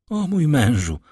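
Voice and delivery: ordinary delivery, nothing marked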